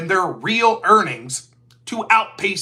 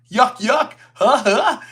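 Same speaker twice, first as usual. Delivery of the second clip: fake chuckle; 3 semitones higher, 3 dB louder, slower than usual. Mocking critics' smug joke.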